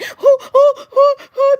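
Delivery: loud, high mock gasping hoots; over-the-top panicked hoots. Hostages reacting every time she moved, loud hammed-up hoots. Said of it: "they were being so extra".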